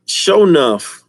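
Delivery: a hint of the Shogun, loud and high; mock Shogun voice. Retelling the movie villain, mock shout. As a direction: boom out the villain's name.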